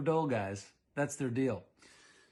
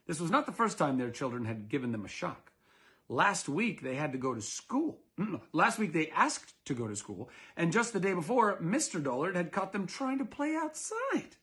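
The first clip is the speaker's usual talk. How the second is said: usual speaking voice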